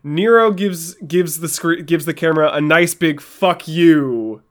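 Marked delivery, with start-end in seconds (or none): 3.2-4.4 mildly mocked shout, louder; a big defiant curse, only half shouted; retold shout. Co-host imitating the character's curse at the camera, mild shout. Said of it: "he just shouts it".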